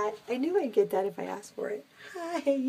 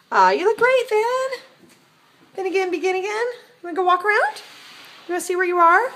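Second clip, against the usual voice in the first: gushing pet talk; 8 semitones higher, 9 dB louder than usual. Real, not acted. Cooing at the cat.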